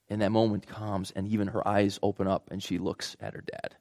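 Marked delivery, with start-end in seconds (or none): none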